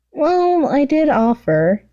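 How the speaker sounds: high, slow pouty shrug; a sulky high girly voice; mock disappointed girl. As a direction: play the sulky villain girl. Toga voiced in read story.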